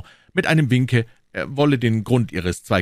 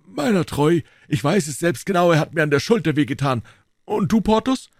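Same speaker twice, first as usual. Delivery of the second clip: high, louder, faster reply; shrugging duelist; Athos; 11 semitones higher, 5 dB louder than usual. Acted. Athos voiced.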